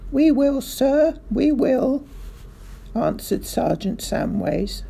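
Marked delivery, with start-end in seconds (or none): 0.1-2.0 high, loud, eager-to-oblige reply; calling back. A narrator's reading. Sergeant answers the bellowing car owner, calling back.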